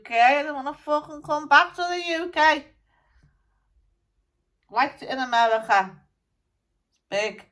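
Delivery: a dead rocker grumbling through a medium; channelled musician spirit. Acted.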